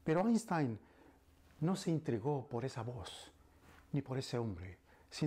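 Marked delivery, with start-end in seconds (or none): none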